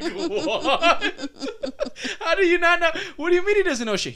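high, laughing incredulous questions; laughing disbelief. Retold or imitated. Retold disbelief at Pop.

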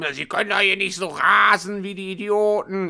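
retold angry yelling. Confused woman scolding a driver through the window, mock angry yell. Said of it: "schreit die mich voll rum".

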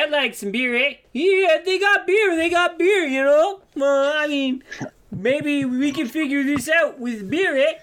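friendly Canadian local pushing for beer; jolly Canadian drawl; Canadian accent. Acted. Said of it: "Hope my accent's spot on".

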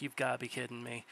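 quiet, deadpan aside as a retold reaction; deflated disbelief. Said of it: "I'm like".

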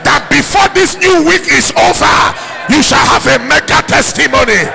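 loud, shouted prophetic declaration; prophesy over the crowd, building to a scream; fired-up preacher shouting out a blessing; full-force prophesying. Real, not acted.

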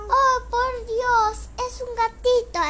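agudo, fuerte y lento, con asombro; mamá sorprendida. Acted. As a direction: exclama como mamá asombrada. (la mamá ve el gatito, muy sorprendida)

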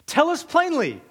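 high, pressing demand; insistent questioner, pitched high; demanding crowd. Acted. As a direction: needle him for an answer.

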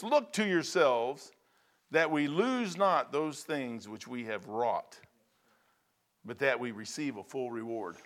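slow, measured reading of the verse; scripture reading. Acted. Preacher reads the verse to the congregation, slow and measured. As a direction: read the verse slowly and gravely.

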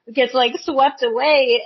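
ordinary talk

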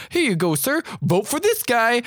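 high-pitched mock enthusiasm; Chris Farley. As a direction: imitate an overeager goofy canvasser. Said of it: "Chris Farley is way too enthusiastic".

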